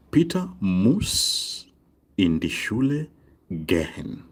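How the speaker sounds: slow, phrase-by-phrase teaching delivery; slow model sentence. Shown as a demonstration. Teacher showing German word order to learners, slow modelling. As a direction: stretch Peter muss, then pause between phrases.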